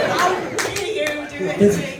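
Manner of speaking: loud, high mock outrage; retold anger. Actress retells berating her prank-playing agent, loud mock fury. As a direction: storm at the agent in hammed-up indignation. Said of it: "I was so angry at him".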